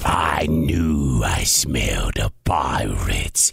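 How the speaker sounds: very low giant voice; bellowing giant. Acted. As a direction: rumble it out like a huge brute. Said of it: "he bellowed".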